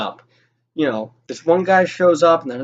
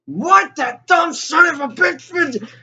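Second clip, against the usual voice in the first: furious shout; 12 semitones higher, slower than usual. Retold or imitated. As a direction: explode at the news, then drag out the insult slowly. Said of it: "He is pissed".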